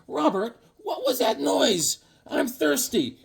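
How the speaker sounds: high slow mother's voice; high put-on mother. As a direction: call him like his mom. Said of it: "his mother's like".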